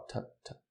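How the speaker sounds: "Ta-" very soft, with a short lazy "uh"; isolated schwa syllable. Shown as a demonstration. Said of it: "Ó o schwa". ("ta-" bem fraquinho, com schwa)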